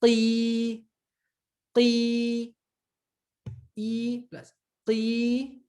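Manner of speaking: qi held high and very slowly; teacher drilling long qi. Shown as a demonstration. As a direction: sustain qi. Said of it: "Here we have".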